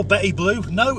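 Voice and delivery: usual speaking voice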